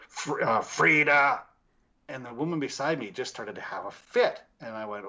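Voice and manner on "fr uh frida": imitating a spirit, loud drawn-out name; a hint of an eerie voice, louder; deep spirit voice. Investigator retells a spirit answering him, imitated deep voice. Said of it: "this deep voice goes".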